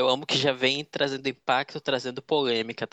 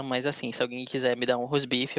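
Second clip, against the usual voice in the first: ordinary delivery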